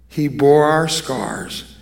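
normal talking voice

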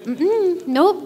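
high, sing-song mock refusal; playful nope. Mom telling daughter the muffins aren't ready, sing-song. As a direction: tease the answer upward.

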